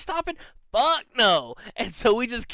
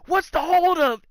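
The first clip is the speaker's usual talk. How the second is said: high, loud call from off screen; dad calling out; 11 semitones higher, 7 dB louder than usual. Retold or imitated. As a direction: shout it like a dad who is out of sight.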